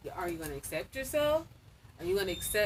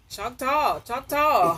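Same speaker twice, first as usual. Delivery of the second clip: loud teasing, higher, drawn-out CHOCTAW; mock mispronouncing; 4 semitones higher, 9 dB louder, much slower than usual. Nephew teasing his aunt, mock-saying Choctaw loudly. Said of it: "I started trying to be funny with it".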